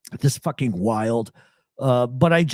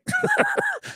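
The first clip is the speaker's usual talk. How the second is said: real high-pitched giggling burst; uncontrollable laugh. Real, not acted. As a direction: crack up at your own retelling. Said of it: "I couldn't stop laughing".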